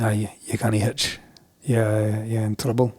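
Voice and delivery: lightly imitated Scottish policeman; wee Scottish accent. Police warning the hitchhiker, in a wee Scottish accent. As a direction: lay down the rules in a Scots accent. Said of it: "do the rest in a wee Scottish accent".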